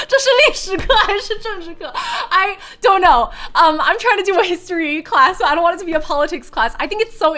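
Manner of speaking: high and loud, just after cracking up; laughing protest. Real, not acted. Cracking up over politics question.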